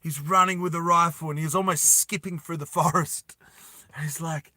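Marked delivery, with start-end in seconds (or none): none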